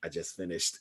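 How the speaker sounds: low, very soft deadpan; subdued recalled text. Retold or imitated. Retelling a text sent after the movie, subdued. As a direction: say it tiny and low, stunned. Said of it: "and was like".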